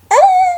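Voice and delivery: high loud fangirl squeal; excited squeal. Real, not acted. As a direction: squeal with delight.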